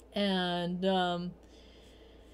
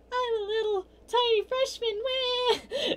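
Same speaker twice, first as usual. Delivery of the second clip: put-on squeaky, much higher little-kid whine; tiny freshman voice; slower than usual. Mocking her freshman self at a college party, squeaky high whine. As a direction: make yourself sound small and helpless.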